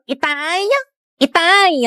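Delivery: high calling for father, repeated. Acted. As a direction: call out to Itay across the house. (tawag nang malakas kay Itay)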